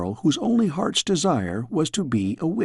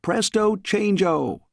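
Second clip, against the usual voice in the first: high, slow yelled incantation; yelled spell; 6 semitones higher, slower than usual. A narrator's reading. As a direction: call out the magic words. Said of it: "she aimed it again at the dress and yelled".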